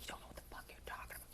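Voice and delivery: mock aggressive line, whispered fast; a whispered know-it-all putdown; whispered aggro inner voice. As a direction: hiss it fast like a snide inner voice. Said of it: "super aggro like that".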